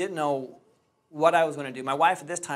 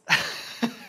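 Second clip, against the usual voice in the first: short breathy laugh; a rueful chuckle; 8 semitones higher, 4 dB louder than usual. Real, not acted. Laughs at his failure.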